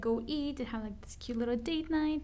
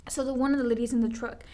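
ordinary talk, nothing marked

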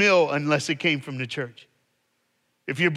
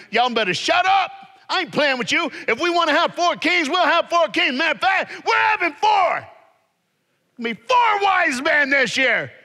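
much higher and louder furious church-lady shout; Sister Rice going off; 8 dB louder than usual. Acted. Sunday school teacher scolding the three boys, furious shouting. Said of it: "Sister Rice went off".

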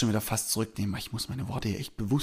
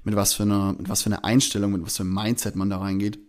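usual speaking voice

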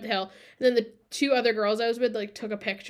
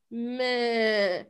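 goat-like groan imitation. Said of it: "it sounds like a goat".

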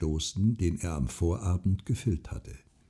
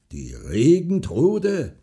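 drawn-out, raised, harsh disbelief; hard, scornful repeat; 5 semitones higher, 5 dB louder, much slower than usual. A narrator's reading. Farmer repeats the woman's word, harshly. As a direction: stretch it out hard and louder. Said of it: "wiederholte der Bauer hart".

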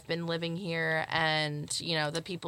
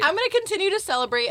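excited; 11 semitones higher, 5 dB louder, much faster than usual. Pretend. High excited repeat.

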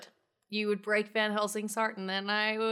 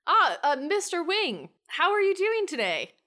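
higher, louder cheerful hello; bright eager greeting; 9 semitones higher, 4 dB louder than usual. Acted. Shar greets Mister Wing, eager and upbeat. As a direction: greet him with eager energy. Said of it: "Shar greets Wing".

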